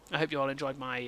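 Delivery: usual speaking voice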